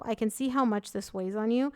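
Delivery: normal talking voice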